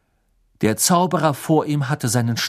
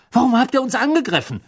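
indignant; 10 semitones higher, faster than usual. A narrator's reading. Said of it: "sah entrüstet seinen Vater an".

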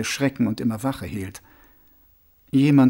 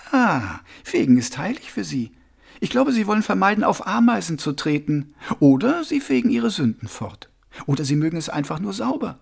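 lightly voiced, higher-pitched woman; Rosi, impatient young woman; 7 semitones higher than usual. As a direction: brush the question off in a higher voice. Rosi explains about monks.

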